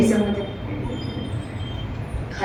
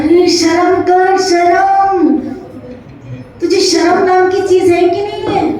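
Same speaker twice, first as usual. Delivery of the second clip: angry sing-song shaming; 9 semitones higher, 7 dB louder than usual. Acted. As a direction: shame him, stretching the words. Conductor shaming the man.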